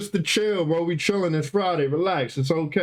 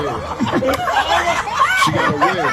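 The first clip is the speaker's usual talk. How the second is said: real laughter taking over the sentence; amused retelling that dissolves into laughter; laughing; 6 dB louder, much slower than usual. Real, not acted. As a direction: try to recount it, then break up laughing. Laughing at the wig clip.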